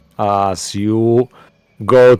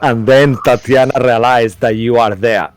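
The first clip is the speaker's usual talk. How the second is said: ordinary talk, nothing marked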